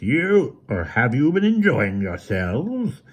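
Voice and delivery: slow, grating villain sneer; mock them slowly, harsh and cold. A narrator's reading. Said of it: "harsh, grating voice".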